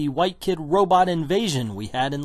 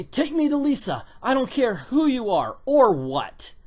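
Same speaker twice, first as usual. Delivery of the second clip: acted demand; 7 semitones higher, 4 dB louder than usual. Acts out America's angry demand.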